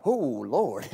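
low, soft, dismayed groan; a deflated gasp at the price; sticker shock groan. Retold or imitated. Car buyer sees the price tag, low soft dismayed groan. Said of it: "you're like".

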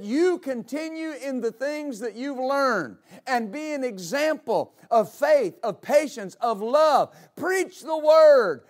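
starts slow and steady, swells to a loud, slow shout on preach the word; rising preacher's crescendo; building to a shout. Acted. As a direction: climb from calm exhortation to a full preaching cry. Preacher quoting Paul, building.

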